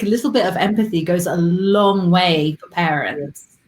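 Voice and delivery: ordinary delivery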